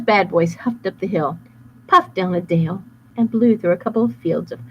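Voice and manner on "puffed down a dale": ordinary delivery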